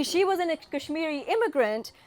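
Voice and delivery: ordinary delivery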